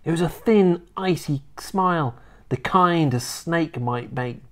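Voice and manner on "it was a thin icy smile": plain reading voice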